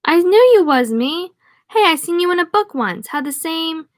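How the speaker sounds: high-pitched, delighted exclamation; excited drunk lumberjack. Acted. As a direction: greet him with drunken amazement, pitched well up. Drunk French lumberjack's delighted recognition.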